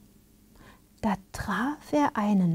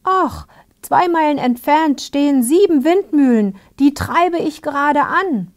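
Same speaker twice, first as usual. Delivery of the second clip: louder, higher storybook character voice; windmill-blowing man; 7 semitones higher, 6 dB louder than usual. A narrator's reading. Blower tells the soldier about the windmills, loud and high. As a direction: play the blower, raised and pitched up.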